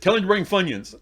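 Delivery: high, loud call across the house; retold shouted order. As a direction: holler the order back, acted out. Dad calling back his order.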